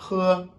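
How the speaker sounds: He high and light, breathed out; He as a light Chinese h. Shown as a demonstration. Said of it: "c'est un peu léger".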